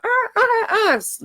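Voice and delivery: hammed-up high wailing sobs; mock wail. Shown as a demonstration. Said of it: "crying, freaking out".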